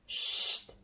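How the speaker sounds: soft, unvoiced shh, drawn out; soft shush. Shown as a demonstration. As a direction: breathe out a gentle shh. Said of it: "je prononce doucement le son chut". (un chut doux et soufflé)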